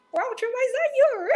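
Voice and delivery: delighted high-pitched greeting; surprised and thrilled to see her; excited. Real, not acted. Host excited to see speaker.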